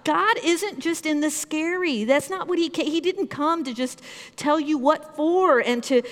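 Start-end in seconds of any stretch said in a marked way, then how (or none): none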